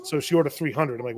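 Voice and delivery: ordinary delivery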